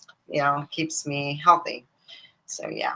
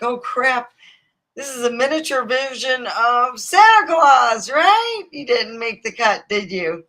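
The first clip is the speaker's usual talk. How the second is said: higher, louder playful jab at a date; mock taunt; 7 semitones higher, 5 dB louder than usual. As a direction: rib him like a smug heckler. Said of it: "I almost felt like saying that".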